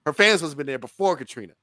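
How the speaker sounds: ordinary talk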